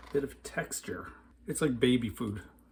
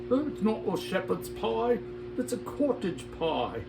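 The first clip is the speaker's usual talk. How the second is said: hammed-up British accent, starting high; horrible British accent; 7 semitones higher than usual. British viewer correcting the pie's name, bad British accent. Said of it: "that was my horrible British accent".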